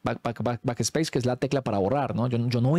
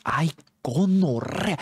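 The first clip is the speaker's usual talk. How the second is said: slow, drawn-out frightened cry; terrified gasp; 4 semitones higher, much slower than usual. Retold or imitated. Boys spooked by the ghost program, drawn-out terrified cry. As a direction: let the fear stretch the word.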